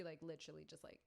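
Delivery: ordinary talk, nothing marked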